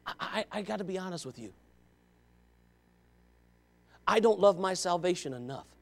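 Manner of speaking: hushed, low and intimate; soft confession. Real, not acted. Preacher confessing to the congregation, very softly.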